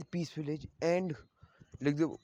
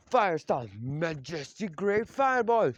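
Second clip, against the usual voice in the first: loud, stretched-out battle cry; attack call; 4 dB louder, slower than usual. Acted. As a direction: announce the technique grandly, drawing it out. Ninjas call out their attack.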